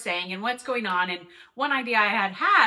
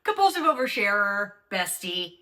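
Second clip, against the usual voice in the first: podcast intro; 5 semitones higher than usual. Acted. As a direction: announce yourself with mock grandeur, stretching each title. Podcast host's stylised intro greeting.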